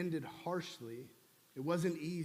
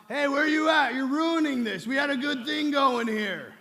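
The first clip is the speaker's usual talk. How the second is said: put-on loud angry yell in a New York accent, much higher; 8 semitones higher, 12 dB louder than usual. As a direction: bark it like a furious New Yorker whose date is ruined. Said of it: "He doesn't yell out".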